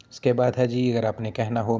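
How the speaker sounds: usual speaking voice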